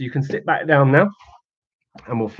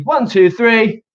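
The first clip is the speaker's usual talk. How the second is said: higher and louder, drawn-out count; loud slow countdown; 6 semitones higher, 7 dB louder, much slower than usual. Real, not acted. Teacher cueing the class shout, loud and slow. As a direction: count them in big and slow.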